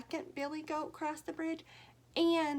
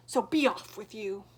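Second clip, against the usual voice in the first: louder troll dismissal; growl; 8 dB louder than usual. Acted. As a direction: shoo the goat off gruffly. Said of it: "growled the troll".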